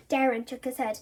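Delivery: plain reading voice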